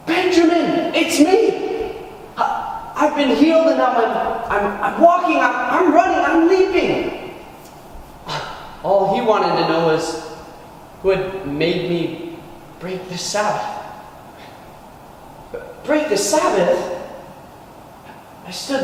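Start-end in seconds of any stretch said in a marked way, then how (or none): 0.1-7.2 stammering, high-pitched excitement; overjoyed announcement. Acted. Healed man tells cousin the news. 15.8-16.9 higher, disbelieving repeat of the charge; pitched-up, incredulous query; incredulous echo. Acted.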